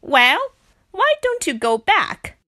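high, loud, hostile outburst; angry cry. A narrator's reading. Pig telling Rat to leave, crying out angrily. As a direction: cry it out as a challenge. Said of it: "cried Pig".

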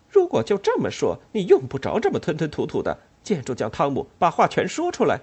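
high, loud impatient demand. Acted. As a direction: push him to spit it out. Ellen demanding Tom speak out.

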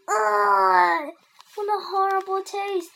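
loud, high cry of disgust; drawn-out groan into dramatic complaint. A narrator's reading. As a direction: react to a revolting mouthful. Henry tastes hated bean.

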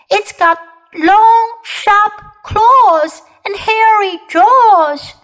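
high, loud and drawn out; frightened child. Acted. Kipper describing the monster to Mom, frightened child. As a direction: stretch each scary detail in a high voice.

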